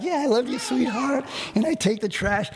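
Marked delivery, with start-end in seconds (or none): 0.0-1.2 forced sweet reply. Retold or imitated. Pastor answering his wife sweetly while fuming inside. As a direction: smile through gritted teeth. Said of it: "but I'm smiling at her".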